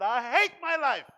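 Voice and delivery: shouted at full force, hating his life; loud cry of despair. Retold or imitated. Pastor retelling his drunk self crying out to God. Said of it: "I said loudly".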